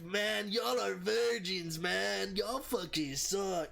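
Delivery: high, slow, drawn-out mock Mexican accent; put-on Mexican accent. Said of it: "he's trying to talk like he's Mexican".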